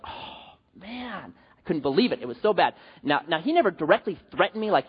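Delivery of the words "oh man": a breathy, deflated "oh, man" under the breath; dismayed groan. Retold or imitated. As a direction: sink into quiet disbelief. Stunned by the lawsuit threat.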